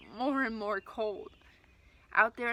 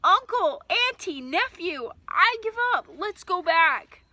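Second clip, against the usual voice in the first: loud, much higher pleading yell; desperate shouting; 8 semitones higher, 10 dB louder, slower than usual. Acted. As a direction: beg at the top of your lungs. Desperate surrender shouted in storm.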